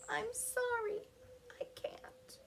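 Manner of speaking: high, soft voice fading to a whisper; sad apology. A narrator's reading. Ricky tells friends he can't roll, sad hushed apology. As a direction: apologise in a small, defeated voice. Sad rock gives up trying.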